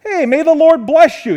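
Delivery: mock-cheerful greeting from a guilty king. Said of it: "Cheerfully, like".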